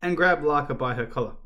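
ordinary delivery

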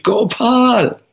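high, drawn-out storyteller's call; mock shout. Boy calls Gopal in the middle of the forest, high shout. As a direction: call the name out high, as in a tale. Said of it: "in the middle of the forest he shouted".

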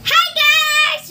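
bright high hello to viewers; excited greeting. Real, not acted. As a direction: greet them with a big lift. Vlog greeting, high and loud.

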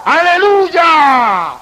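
a preacher voicing the heavenly voice that cries out, booming shout. A narrator's reading. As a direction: bellow "¡Aleluya!" slowly like the voice from heaven. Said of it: "Y esa voz grita".

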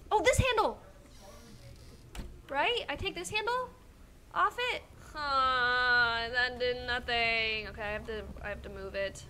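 ordinary delivery

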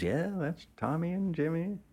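matronly woman. Retold or imitated. Gas station woman spots Charlie, high excited matronly voice. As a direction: squeal it out like an excited country matron. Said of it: "she goes".

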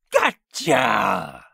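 loud, drawn-out gleeful scream; screamed taunt. A narrator's reading. Malevolent face over pinned man, screaming. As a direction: scream it with evil glee. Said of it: "screamed the mouth".

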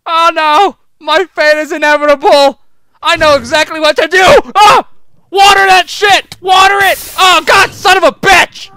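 loud, high frantic yells; frantic shouting. Real, not acted. Gamer yelling frantically while trying to put out a fire, panicked. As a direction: shout in a scramble to fix it.

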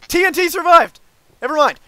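high, loud shout of alarm; excited yelling. Real, not acted. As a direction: shout it out in sudden panic. Sudden alarm in game.

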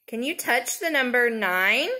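ordinary delivery

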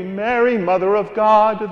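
ordinary talk

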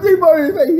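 very high, garbled mock-laughing squeal; imitating laughing friend. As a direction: squeeze the words out as if helpless with laughter. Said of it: "he's laughing so hard".

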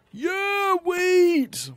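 high, loud squeal of joy; excited cheer. Real, not acted. Gamer thrilled his wheat finally grew, excited cheer. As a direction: cheer like you've won the lottery. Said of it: "Getting excited just for wheat".

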